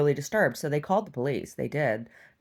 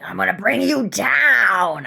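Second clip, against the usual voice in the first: slow, louder threatening put-on voice; menacing imitation; 3 semitones higher, 7 dB louder, slower than usual. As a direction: threaten slowly like the crazy woman. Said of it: "she's like".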